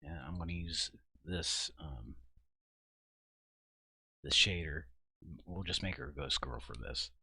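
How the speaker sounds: usual speaking voice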